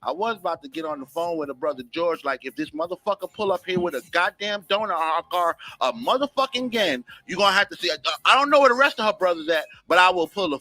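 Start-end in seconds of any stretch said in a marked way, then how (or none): none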